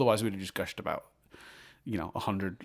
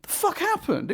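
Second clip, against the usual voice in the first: retold confusion. Watching the movie, baffled, high disbelieving outburst. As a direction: blurt it out in disbelief. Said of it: "I was watching it like".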